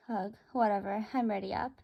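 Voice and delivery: ordinary delivery, nothing marked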